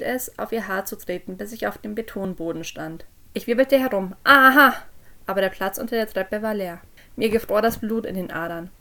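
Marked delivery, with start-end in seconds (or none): 4.2-4.7 loud gotcha cry; triumphant shout. A narrator's reading. Parent whirling round to catch Jess, loud triumphant shout. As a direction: burst out as if catching someone. Springing on the hidden person.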